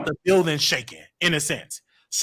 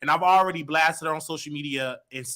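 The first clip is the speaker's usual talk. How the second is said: ordinary talk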